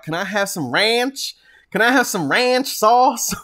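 high, loud, drawn-out American impression; hammed-up American ordering, slow and high-pitched; mock American accent. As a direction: exaggerate an American "ranch" with a flat a. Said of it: "Americans are like".

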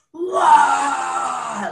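loud high wail of a sob; crying wail. Retold or imitated. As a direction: let out one loud crying howl. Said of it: "cried the whole way home".

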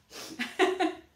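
high breathy giggle; real laugh bursting out. Real, not acted. Groomer giggling about mom's ruined wig.

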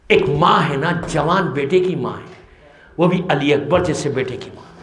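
low, grieving majlis recitation; mournful lament recitation. Acted. Preacher recalling the mother at Karbala to mourners, low lamenting recitation.

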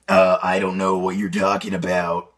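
very low demonic droning; deep throat voice. Shown as a demonstration. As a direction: drop into a deep unnatural drone. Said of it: "robot voice or, uh, old lady voice".

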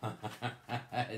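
low staccato chuckle; laugh. Pretend. Teacher reacting to children shouting answers, laughing. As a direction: chuckle in a quick run.

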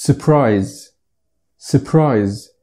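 surprise said with a silent first r, "suh-prise"; surprise: drop the first r sound; correct English pronunciation. Shown as a demonstration. Teacher modelling surprise for Arabic learners, clear and slow. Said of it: "فهي بتتقال سربرايز، الار سايلنت".

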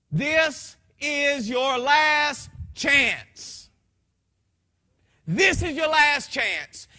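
slow, loud, commanding words from above; voice of God. Retold or imitated. God speaking to a dying man, slow and commanding. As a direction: proclaim it slowly with full authority. God's voice in testimony.

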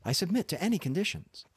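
high, hurried plea; crying out. A narrator's reading. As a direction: blurt it out fast and high in surrender. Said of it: "I cried".

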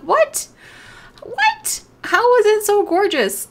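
very high, loud squealed "What?"; amazed. Real, not acted. To the viewers about the ocelot, a high squeal of amazement. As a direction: squeal in disbelief.

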